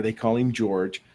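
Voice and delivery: ordinary delivery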